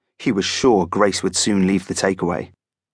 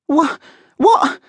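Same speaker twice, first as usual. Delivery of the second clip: very high, stammered squeak; frightened squeak; 4 dB louder than usual. A narrator's reading. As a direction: squeak it out as a shaking boy. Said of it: "squeaked Joe, shaking".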